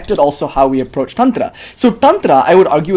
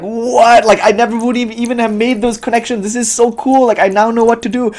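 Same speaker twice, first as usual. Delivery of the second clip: high, eager gasp of discovery; amazed excitement; 8 semitones higher than usual. Retold or imitated. Reader amazed by the Tantra Loka, excited and high. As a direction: sound thrilled at each new connection.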